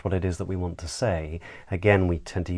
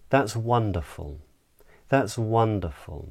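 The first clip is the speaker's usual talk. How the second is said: "wonderful" falls as a finished statement; downward inflection. Shown as a demonstration. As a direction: let the pitch drop through "wonderful". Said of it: "I would use this downward inflection".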